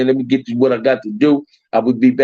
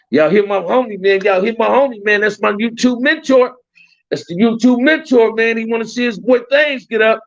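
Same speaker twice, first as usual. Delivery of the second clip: high-pitched, insistent shout-out; hyping up; 9 semitones higher than usual. Real, not acted. Streamer urging viewers to back his YouTube mentor, excited hype. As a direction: talk up your friend to the crowd, pitched high.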